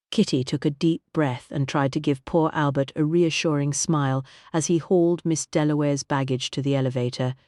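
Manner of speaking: usual speaking voice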